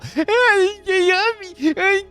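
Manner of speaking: very high, drawn-out wavering mock wail; imitated sleep struggle. Vlogger showing how he looked held down asleep, mock wailing squeal. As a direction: squeal like someone pinned down in a nightmare. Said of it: "you're probably gonna see me go".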